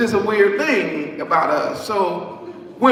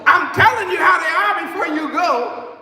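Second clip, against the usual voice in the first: the Lord insisting; 7 semitones higher than usual. Retold or imitated. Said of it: "He said".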